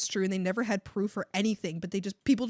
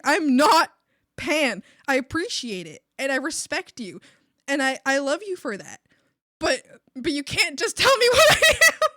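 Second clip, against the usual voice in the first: loud indignant retort, building to a yell; annoyed; 5 semitones higher, 10 dB louder than usual. Retold or imitated. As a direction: re-enact an exasperated protest, ending in a laughing shout. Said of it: "I got real annoyed at her".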